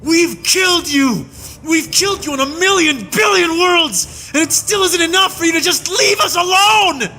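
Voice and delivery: very high furious scream, building to the end; screaming in rage. A narrator's reading. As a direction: scream the horrors at her. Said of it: "Farnes screamed".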